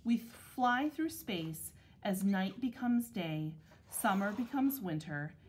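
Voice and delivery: ordinary delivery, nothing marked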